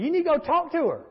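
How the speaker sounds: high-pitched, bossy demand; retold indignation. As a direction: imitate an angry woman giving orders. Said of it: "a lady who got mad at me".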